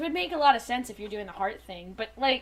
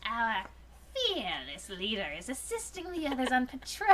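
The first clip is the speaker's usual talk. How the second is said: a hint of a gruff robot; half-put-on villain voice; Dinobot voice; 6 dB quieter than usual. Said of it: "That's so not Dinobot".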